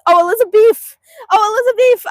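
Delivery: high loud affectionate coo; cooing to cow. Real, not acted. Owner greeting beloved cow Elizabeth, high affectionate coo. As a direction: gush over her like a pet.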